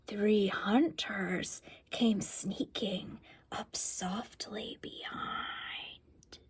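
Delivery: slow, hushed whisper fading very soft; sneaky whisper. A narrator's reading. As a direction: creep the words in like hunters. Hunters sneaking up.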